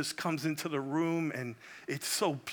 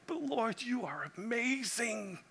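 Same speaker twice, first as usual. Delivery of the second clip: awed and hushed, rising high then soft; a soft, high whisper of wonder to the Lord; hushed awe; 7 semitones higher, 6 dB quieter than usual. Retold or imitated. Pastor marvelling at Jesus, soft and high with awe. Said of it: "You're like".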